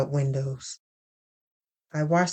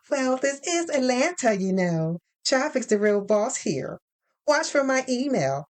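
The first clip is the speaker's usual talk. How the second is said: higher, bright professional phone voice; HR woman on the phone; 7 semitones higher than usual. Acted. Lynn from HR announcing finalist news, higher brisk phone voice. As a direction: sell the good news like a cheerful recruiter.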